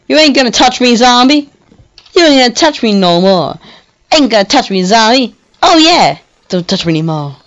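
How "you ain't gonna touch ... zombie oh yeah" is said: high, loud cocky taunt; mock the zombie boldly. Real, not acted. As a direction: gamer trash talk.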